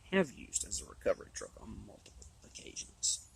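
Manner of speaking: losing voice, hoarse near-whisper; worn-out hoarse voice. Real, not acted. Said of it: "I'm losing my voice here".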